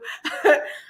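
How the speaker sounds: short airy chuckle. Real, not acted. Laugh after thanking donor.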